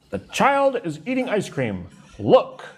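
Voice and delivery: high excited exclamation. Shown as a demonstration. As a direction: call out in excitement. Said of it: "written as an exclamation".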